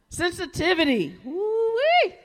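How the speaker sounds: loud, rising call-out with a whoop; excited shout. Real, not acted. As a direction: whoop it out to the congregation. Preacher calls out with crowd.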